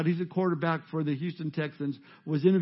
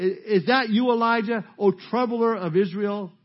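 slow, higher, declaiming king; accusing king; 3 semitones higher, 4 dB louder than usual. Acted. King spotting the troubler of Israel, accusing and declaimed. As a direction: call him out slowly, pitch lifted. King confronting the prophet Elijah.

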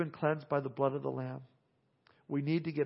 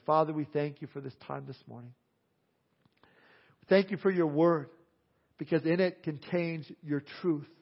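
prayer; 4 dB louder than usual. Acted. Pastor praying over his congregation after the sermon, earnest. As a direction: pray aloud for the room, gently.

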